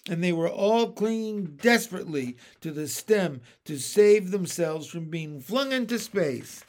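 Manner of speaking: plain reading voice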